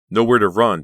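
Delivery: loud; trapped; panic. A narrator's reading. Trapped thought.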